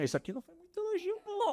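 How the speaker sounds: squeaky high teasing voice; high mock voice. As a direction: pitch it way up, playful. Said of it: "eu falo assim".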